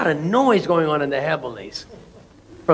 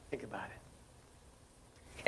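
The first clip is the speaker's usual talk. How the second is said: hushed whisper, slow; soft whispered challenge; 7 semitones lower, 30 dB quieter, much slower than usual. Real, not acted. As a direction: breathe it so they lean in. Whispered aside after key line.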